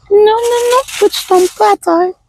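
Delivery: high scolding singsong. Pretend. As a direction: scold the falling box like a child. Talking to falling cookie box. (ton de reproche aigu)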